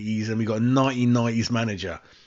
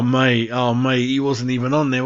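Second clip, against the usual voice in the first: ordinary talk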